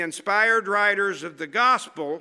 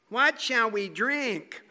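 whiny complaint; 2 semitones higher, slower than usual. Retold or imitated. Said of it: "You've got to get the right intonation".

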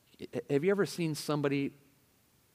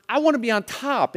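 self-centred insistence; 9 semitones higher, 5 dB louder than usual. Acted. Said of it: "It's a concern for".

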